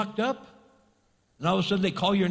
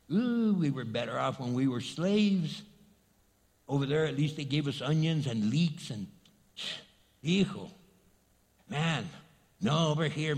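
mock whining; 8 semitones lower, 4 dB quieter than usual. Israelites complaining about manna.

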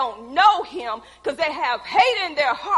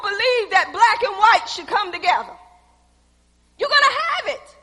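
loud, high, building sermon cry; preaching at full force; 5 semitones higher, 8 dB louder than usual. Acted. Preacher rousing the church, loud and rising. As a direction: drive it home to the congregation.

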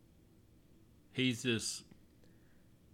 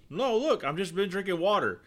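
higher, protesting innocence to the cop; the driver pleading innocence, pitched up; mock innocent driver; 7 semitones higher than usual. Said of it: "And he goes".

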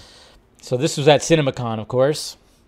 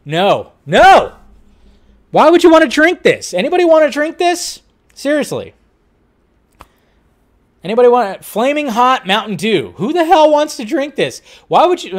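much higher and louder, appalled; disgusted outcry; 10 semitones higher, 6 dB louder than usual. Real, not acted. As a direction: yell in real disbelief at a gross drink. Reacts to Flaming Hot Mountain Dew.

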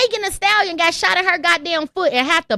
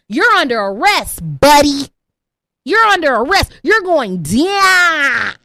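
louder, lower stern cop; mock police voice; 4 semitones lower, 4 dB louder, slower than usual. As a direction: bark the arrest like a cop. Police arresting the man.

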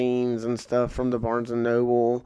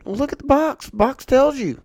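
much higher voice, lecturing; mock old shooter; 13 semitones higher than usual. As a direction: show off like a smug veteran. Old shooter gives advice.

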